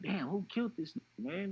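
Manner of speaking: grieving, asking who did it; retold anguished question.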